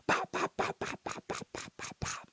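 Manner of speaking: breathy popping gunfire sounds; gunshot noises. Retold or imitated. Retelling Hernandez firing at the man, fading breathy pops. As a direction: spit out pops like a pistol, fading.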